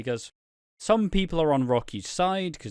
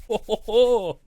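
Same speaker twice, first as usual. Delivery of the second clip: high hammed-up ho ho ho laugh, louder at the end; mock ho ho ho; 9 semitones higher, 6 dB louder than usual. Friend laughing at the price joke with a hammed-up ho ho ho. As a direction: belt out a jokey festive laugh.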